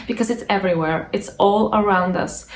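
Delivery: ordinary talk, nothing marked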